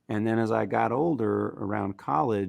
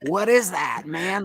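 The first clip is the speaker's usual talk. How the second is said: amazed question; 10 semitones higher, 7 dB louder, slower than usual. Retold or imitated. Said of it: "And I was".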